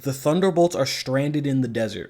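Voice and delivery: ordinary talk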